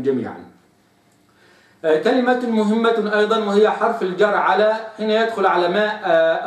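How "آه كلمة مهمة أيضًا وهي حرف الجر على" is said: ordinary talk, nothing marked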